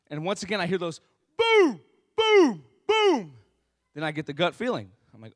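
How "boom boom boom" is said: high, loud falling booms; loud bangs. Retold or imitated. Storyteller hears the knocking return, loud imitated bangs. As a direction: hoot out each knock dramatically. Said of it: "three loud bangs on my door".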